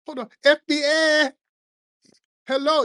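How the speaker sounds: high, mock official call at the door; mock FBI knock. Comedian voicing agents knocking in a high mock voice. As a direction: call through the door in a put-on voice.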